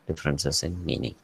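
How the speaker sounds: normal talking voice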